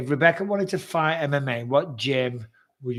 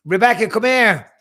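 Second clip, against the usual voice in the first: higher, louder call across the room; 6 semitones higher, 9 dB louder than usual. Real, not acted. Calling his girlfriend Rebecca to come over, raised voice. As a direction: call her in from the next room.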